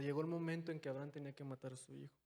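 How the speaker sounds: ordinary talk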